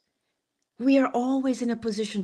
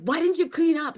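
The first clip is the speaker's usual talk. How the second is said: mock scolding; 9 semitones higher than usual. Retelling snapping at son.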